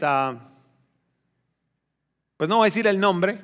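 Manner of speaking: ordinary talk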